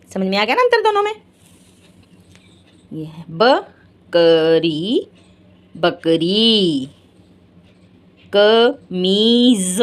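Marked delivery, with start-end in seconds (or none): 2.9-5.1 slow teaching. Shown as a demonstration. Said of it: "picture reading for kids". 5.7-6.9 slow teaching. Shown as a demonstration. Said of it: "picture reading for kids". 8.3-9.8 slow, drawn-out कमीज; slow picture-word teaching; stretched demo. Shown as a demonstration. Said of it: "ज के नीचे बिंदी ज".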